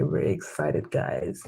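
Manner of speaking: breathy, hushed thrill; excited whisper. Real, not acted. Student whispers excitedly to her live stream viewers. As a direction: whisper it like a secret.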